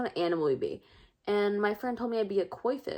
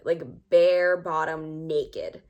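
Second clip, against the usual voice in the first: drawn-out, louder "bare bottom naked" with "bare" stretched and hit hard; slow emphasis on bare; 3 semitones lower, 5 dB louder, much slower than usual. Real, not acted. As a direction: lean hard into "bare", dragging it out. Emphasising how fully naked.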